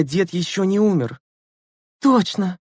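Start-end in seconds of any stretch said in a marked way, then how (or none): 2.0-2.6 high-pitched burst of joy; joyful exclamation. A narrator's reading. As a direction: exclaim it gladly, voice leaping up. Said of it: "радостно воскликнула Лена". (радостно, высоким голосом)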